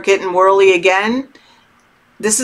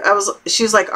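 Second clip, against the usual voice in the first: usual speaking voice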